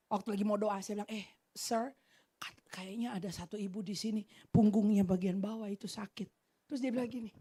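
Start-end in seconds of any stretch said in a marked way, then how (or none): 0.1-6.3 hushed quick aside; whispered tip. Retold or imitated. Young [name] tells her mentor the word quietly, hushed. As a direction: lean in and say it under the breath. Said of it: "saya bisikin dia".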